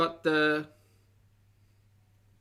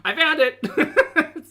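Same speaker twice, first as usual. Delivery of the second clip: laughing; 11 semitones higher, 8 dB louder, much slower than usual. Real, not acted. Laughs on finding the word.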